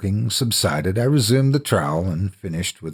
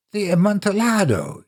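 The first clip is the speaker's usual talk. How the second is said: higher, sudden cry of delight; startled exclamation; 12 semitones higher than usual. Acted. Friend in the vault startled by the Amontillado, exclaiming. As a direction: blurt out the wine's name in surprise. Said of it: "ejaculated my friend".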